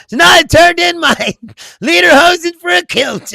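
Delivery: loud, high, hammed-up boast; mock commercial guy. Man in ancestry commercial swapping his costume, mock proud shout. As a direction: proclaim it in an overblown testimonial voice. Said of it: "And then he's like".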